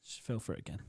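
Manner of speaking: quick unvoiced aside; whispered aside. Real, not acted. Telling his mate the customer fell for it, whispering. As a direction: whisper it to the listener.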